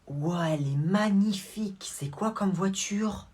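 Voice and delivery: slow, breathy whisper; awed whisper. Acted. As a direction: whisper it in wonder. Girl whispers, dazzled by car.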